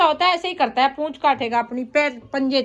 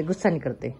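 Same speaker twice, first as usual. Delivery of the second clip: "गुस्सा नहीं करते" dropped much lower and softer, calming; gentle low reassurance to a pet; soft soothing; 11 semitones lower, 6 dB quieter than usual. Real, not acted. Soothing the pet biting its tail.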